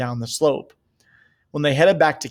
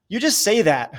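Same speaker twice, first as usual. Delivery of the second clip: sobbed; 6 semitones higher than usual. A narrator's reading. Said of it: "Cole sobbed".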